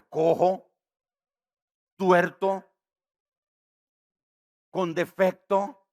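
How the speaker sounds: loud, grieved divine reproach; God's voice. Acted. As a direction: thunder it as God's rebuke. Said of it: "Y el Señor dice".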